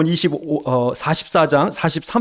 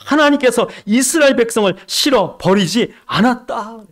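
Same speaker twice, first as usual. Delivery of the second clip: higher, full-voiced restatement; voiced proclamation; 7 semitones higher, 3 dB louder than usual. Preacher to congregation, repeating the promise in full voice. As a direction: proclaim it plainly to the room.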